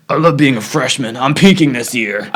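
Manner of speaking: put-on freshman voice; mock freshman. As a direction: make fun of the freshmen in a put-on voice. Mocks freshmen while walking past.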